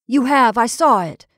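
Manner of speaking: insisting. Acted. Perry insisting.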